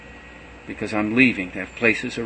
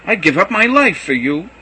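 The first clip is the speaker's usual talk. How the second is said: higher and louder, declaring loyalty; fervent vow; 8 semitones higher, 8 dB louder than usual. A narrator's reading. Peter vowing to die for Jesus, fervent and raised. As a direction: swear it with all your heart.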